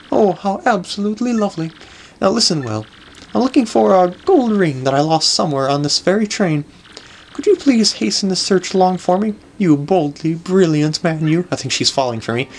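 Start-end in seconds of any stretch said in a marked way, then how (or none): 0.1-11.5 lilting, flattering lady voice; gushing grand lady. Acted. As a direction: lay on the admiration like a grand dame.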